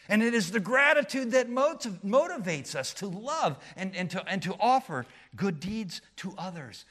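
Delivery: loud, high preaching swell; rising pulpit fervor; preaching at full force. Acted. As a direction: lift the congregation on gratitude.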